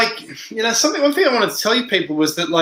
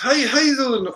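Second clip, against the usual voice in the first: higher-pitched try at a northern English accent; 7 semitones higher than usual. Shown as a demonstration. Streamer hunting for the voice, attempted northern English accent. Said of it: "how do you do the northern accent".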